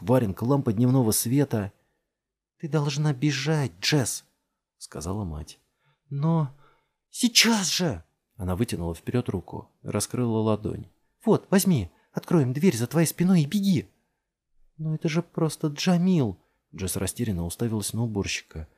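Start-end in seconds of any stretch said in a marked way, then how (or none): 2.6-8.1 urgent mother. A narrator's reading. Mother urges flight. 11.3-13.9 high, loud hurried urging; urgent mother. A narrator's reading. Mother to Jess, high and loud, urgent. 14.8-16.4 bewildered protest. A narrator's reading. Jess protests.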